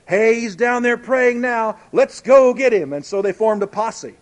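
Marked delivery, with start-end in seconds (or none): 0.1-2.9 rising, urgent, louder call to go get him; egging the others on to grab Daniel; conspirators' eager call. Retold or imitated. Plotters urging each other, eager, louder. Plotters voiced in retold story.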